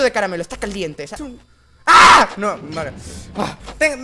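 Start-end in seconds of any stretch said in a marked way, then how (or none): 1.9-2.3 sudden high loud shriek of fright; short startled scream; real scream. Real, not acted. Player caught by a jumpscare, startled scream.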